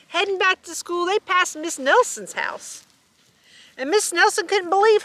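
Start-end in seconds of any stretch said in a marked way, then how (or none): none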